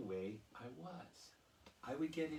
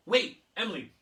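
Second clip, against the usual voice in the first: eager interruption; 10 semitones higher, 15 dB louder than usual. Retold or imitated. Kid with an idea.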